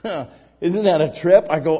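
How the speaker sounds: usual speaking voice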